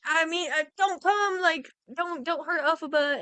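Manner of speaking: high, hurried plea; frantic pleading. Retold or imitated. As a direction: beg them to stop, panicked. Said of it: "she's like".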